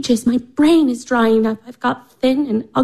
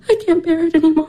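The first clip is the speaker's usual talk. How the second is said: higher, tearful outburst; I can't bear it, crying out; 6 semitones higher, much faster than usual. Acted. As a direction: voice breaking into sobs. Irina breaking down in tears.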